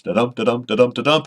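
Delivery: "da-dum" four times in an even weak-strong pulse; four iambs. Shown as a demonstration. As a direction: count out the meter with bouncing "da-dum", stress on "dum". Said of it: "if there's four iambs".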